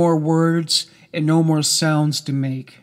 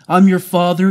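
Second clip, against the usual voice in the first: louder, forceful authority; forceful, angry narrated quote; angry assertion; 3 semitones higher, 7 dB louder than usual. A narrator's reading. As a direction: lay down the law, raised voice.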